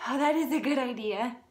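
ordinary delivery, nothing marked

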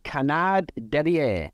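slow, deliberate French "Canard derrière"; mock French. Shown as a demonstration. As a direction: say "Canard derrière" slowly, in a playful French accent. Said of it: "I'm gonna say it in French".

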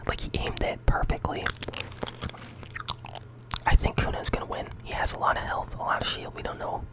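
whispering with a mouthful of jawbreakers; sucking candy. Real, not acted. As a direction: talk around candy in your mouth. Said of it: "I can't even really talk like this".

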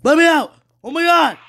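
piercing high terrified scream; scared horror game streamer. Real, not acted. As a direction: scream for your life. Trapped, chased by monster.

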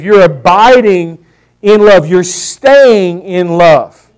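slow, loud preaching; preaching with force. Acted. Preacher pressing the point on his congregation, slow and loud. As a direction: drive each word home, slowly and loud.

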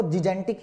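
जिजेंटिक with two soft j sounds; correct soft-g pronunciation. Shown as a demonstration. Teacher gives the actual pronunciation, soft-g जिजेंटिक. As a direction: begin जिजेंटिक as "ji-jen". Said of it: "actually में pronunciation देखें".

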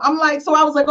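ordinary talk

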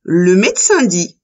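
ordinary delivery, nothing marked

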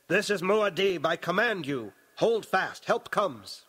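high, forceful order; loud command. Acted. Paul commands his panicking troops.

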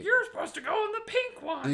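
high, loud mock scold. Imagined attendant pointing someone to the pink one, high mock scold. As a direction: play a fussy rule enforcer. Mock gendered porta potty rule.

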